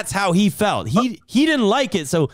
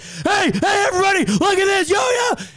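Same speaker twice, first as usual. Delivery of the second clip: mocking a taunting friend; 13 semitones higher than usual. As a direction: ham up an obnoxious chat-spammer calling everyone over. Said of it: "he kept spamming it in my chat".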